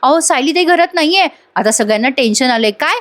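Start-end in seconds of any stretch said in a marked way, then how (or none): 0.0-1.3 high, urgent call to the sister-in-law; retold maid's panicked call; alarmed calling out. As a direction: rush in breathless with bad news. Maid runs in, alarmed.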